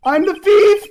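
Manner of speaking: high, loud blurted confession; sudden shout. Acted.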